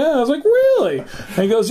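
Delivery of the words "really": surprised. Retold or imitated. Surprised reply.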